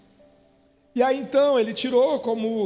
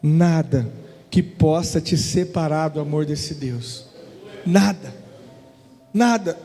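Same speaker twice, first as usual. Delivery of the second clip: preaching at full force; 6 semitones lower than usual. Acted. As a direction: declare it like a pulpit climax. Climactic sermon declaration. (pregação com força, martelando nada)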